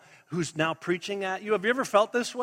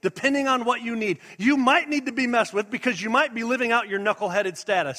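ordinary talk

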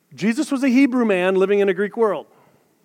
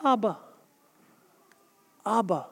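put-on child calling dad; 11 dB quieter than usual. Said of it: "and the kid saying".

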